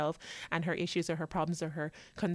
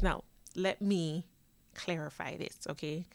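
ordinary delivery

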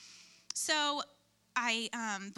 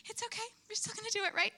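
high, softish retold pleading; anxious self-reassurance; 9 semitones higher than usual. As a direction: lift it high, seeking reassurance from God.

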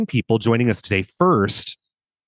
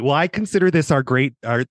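ordinary delivery

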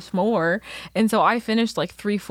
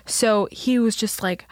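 ordinary talk

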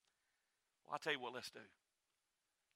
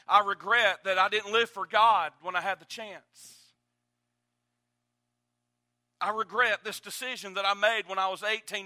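loud, high, rising preacher's cry; full-force preaching; 6 semitones higher, 17 dB louder than usual. Acted. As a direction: preach each regret harder. Preaching the regrets aloud.

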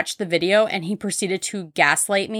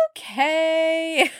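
meek okay; 9 semitones higher than usual. Retold or imitated. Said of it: "And he was like".

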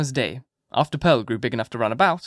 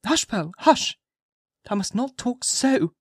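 high, pained hushing; pained mother's reproof; suppressing a groan; 11 semitones higher, slower than usual. A narrator's reading. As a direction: hush the child, holding back distress. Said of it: "suppressing a groan".

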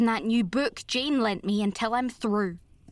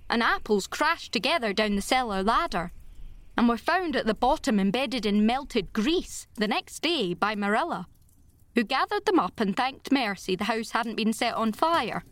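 usual speaking voice

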